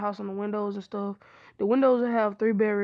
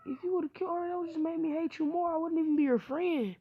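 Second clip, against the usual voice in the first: upset rejection; 7 semitones higher than usual. Acted. Naruto rejects Hinata's confession.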